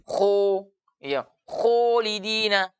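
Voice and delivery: "kholidina" with a rounded, nearly closed o; itbak, mulut tertutup. Shown as a demonstration. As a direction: say "kholidina" slowly with over-rounded lips. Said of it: "sifat itbak namanya seperti itu". ("kholidina" dengan mulut seakan tertutup)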